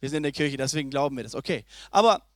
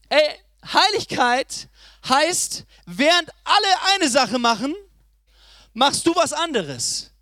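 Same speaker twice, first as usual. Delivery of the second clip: slow emphatic; 3 semitones higher, 3 dB louder, slower than usual. Acted. Preacher defines holiness.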